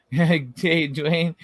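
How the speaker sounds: ordinary talk, nothing marked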